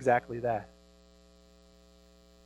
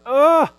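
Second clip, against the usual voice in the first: sharp high straining grunt; imitated effort grunt; 12 semitones higher, 13 dB louder than usual. Pastor imitating daughter Abby snapping the stick, high grunt. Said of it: "And then she goes".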